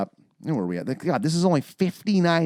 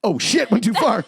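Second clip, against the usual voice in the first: high, loud mock panic; mock flustered; 7 semitones higher, 5 dB louder than usual. Mocking the forest nymph who abandons them, flustered. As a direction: gasp it like a ditzy guide.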